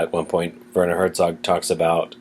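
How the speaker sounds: ordinary talk, nothing marked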